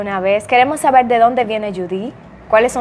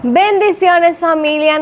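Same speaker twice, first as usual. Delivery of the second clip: upbeat greeting; 7 semitones higher, 3 dB louder, slower than usual. Real, not acted. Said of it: "podcast host greeting".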